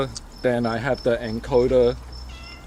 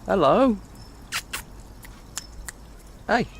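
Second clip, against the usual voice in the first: talking to a cat; 10 semitones higher than usual. Real, not acted. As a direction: call up brightly to the cat. Greeting an approaching cat.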